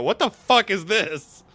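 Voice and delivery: slow, loud baffled cry; bewildered outburst. Retold or imitated. A kid watching the show late at night, loud and baffled. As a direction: react like you can't believe it. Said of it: "you go".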